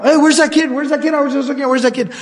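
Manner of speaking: lightly acted frantic searching; mock freak-out. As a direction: imitate a fortune teller starting to panic. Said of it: "starts to freak out".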